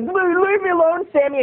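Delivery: put-on high, stammered kid's plea; imitated friend's protest. Narrator's friend fends off the camp bully, high stammering protest. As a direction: imitate a flustered boy begging off.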